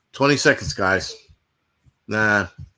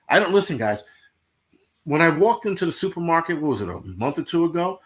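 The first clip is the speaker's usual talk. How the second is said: ordinary talk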